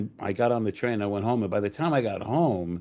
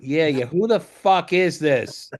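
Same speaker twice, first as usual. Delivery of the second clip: slow, raised, impatient demand; exasperated retort; 6 semitones higher, 4 dB louder, slower than usual. Retold or imitated. Writer answers the second suspected prank call, slow exasperated retort. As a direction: drag it out, not buying the act.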